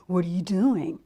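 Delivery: low-pitched animal voice; casual Raccoon. A narrator's reading. Raccoon asks Bird as he passes, low casual voice. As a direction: ask it down low as a storybook raccoon. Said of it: "said Raccoon".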